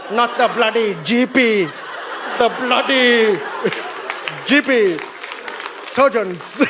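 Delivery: put-on Indian accent with relished consonants; emphatic mock Indian accent. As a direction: boast in a comic Indian accent, pausing for laughs. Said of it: "The Indians are like".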